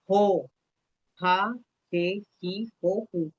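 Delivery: ro, ra, re, ri, ro, ru said slowly with a throaty rasp like a Brazilian R; sílabas com J espanhol, bem devagar; Spanish J syllables. Shown as a demonstration. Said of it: "Presta atenção. O som de J é".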